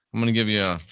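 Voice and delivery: ordinary talk, nothing marked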